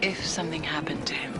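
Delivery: hushed worried whisper. Acted. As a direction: breathe it under your breath. Worried aside to himself.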